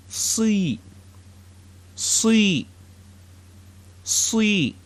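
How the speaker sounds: "si" with a heavy emphatic S. Shown as a demonstration. Teacher models the emphatic sad sound, heavy and dark. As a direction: darken the S in "si" from the throat. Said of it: "With the kesra, you get".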